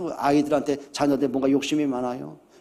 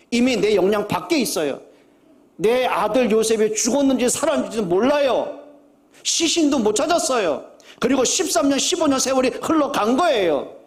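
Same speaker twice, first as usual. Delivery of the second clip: impassioned preaching; 7 semitones higher than usual. Acted. Pastor pressing his congregation about Jacob's loss, rising fervour. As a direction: build the fervour higher and higher.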